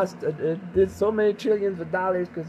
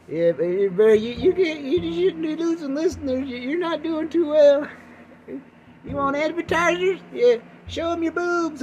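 high, mock-scolding executive voice; Spotify exec; 9 semitones higher than usual. Spotify scolding the host about lost listeners, high mock nagging. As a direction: nag him like a pushy boss. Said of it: "Spotify's like".

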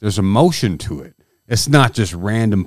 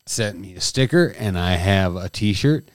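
ordinary talk, nothing marked

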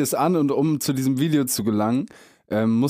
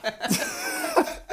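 a burst of squeaky laughter; high squealing laugh; 6 dB quieter than usual. Real, not acted. Punchline about his own song.

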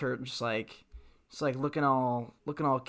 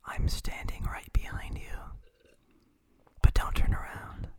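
whispered mock menace; creepy whisper. Co-host creeping out the listener, eerie whisper. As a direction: whisper like someone lurking behind the listener. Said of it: "if I speak like this".

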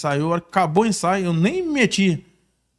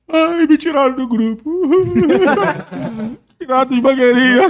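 mock crying; 7 semitones higher, 6 dB louder than usual. Boy tells his dad he was kicked out, hammed-up loud crying. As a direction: imitate a kid bawling, hammed up for laughs. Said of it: "o Lafantani começou a chorar".

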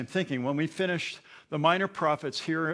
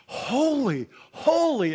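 much higher, louder "holy, holy"; angelic cry; 11 semitones higher, 6 dB louder, slower than usual. Shown as a demonstration. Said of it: "They were".

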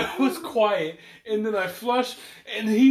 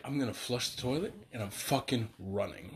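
much softer, lower, like a hushed thought; inner voice hushed; 8 semitones lower, 13 dB quieter than usual. Retold or imitated. Retelling his secret plan to flee, hushed and low. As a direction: murmur your escape plan to yourself. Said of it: "I was like".

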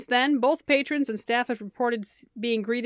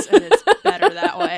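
laughing; 9 semitones higher, 9 dB louder than usual. Real, not acted. Laughing at dark joke.

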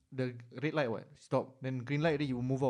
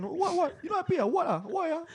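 high mocking challenge; confrontational mock. Driver confronting the riders, high mock. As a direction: ape the angry driver, high.

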